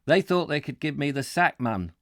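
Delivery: attempted Geordie accent. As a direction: have a go at Geordie, half-hearted. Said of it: "I can't do a Geordie accent".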